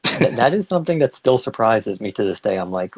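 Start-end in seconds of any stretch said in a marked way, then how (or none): none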